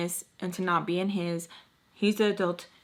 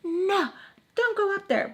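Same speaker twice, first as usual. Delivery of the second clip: high mock scolding voice, much higher; imitating Missus Peach; 12 semitones higher than usual. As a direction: squeak it out like a fussy mom. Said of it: "I'm waiting for her to be like".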